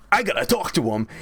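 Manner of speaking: lightly voiced eager insistence; [name] insisting.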